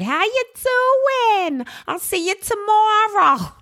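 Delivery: very high, drawn-out Jersey godmother; strong New Jersey accent. Retold or imitated. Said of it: "one of the strongest New Jersey accents".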